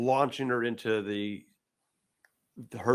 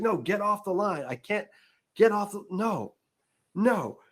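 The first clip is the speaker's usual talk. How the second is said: higher-pitched, mock-flustered pleading; frustrated; 8 semitones higher than usual. Giant woman fighting off a second phone call, frustrated. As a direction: act out mild exasperation on a higher pitch. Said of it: "which is really frustrating".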